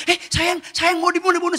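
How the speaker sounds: high-pitched mock alarm; imitating worried wife. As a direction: play a wife fretting over the film. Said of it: "Istri saya". (menirukan istri yang panik)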